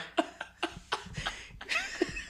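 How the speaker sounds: shrill laughter, unvoiced wheeze; high squealing laugh. Real, not acted. Cracking up at the joke.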